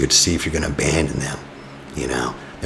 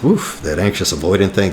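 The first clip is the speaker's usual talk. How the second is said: usual speaking voice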